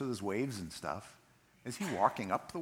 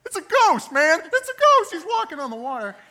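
high-pitched panicked shouting; acted shriek at seeing a ghost; freaked out; 14 dB louder than usual. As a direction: scream it in hammed-up terror. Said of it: "It means they were freaked out".

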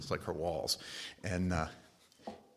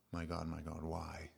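soft, fading plea ending in a whisper; hushed anguished cry; 3 semitones lower, 8 dB quieter than usual. Retold or imitated. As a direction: let the why fall away to a whisper. Jesus crying out on the cross.

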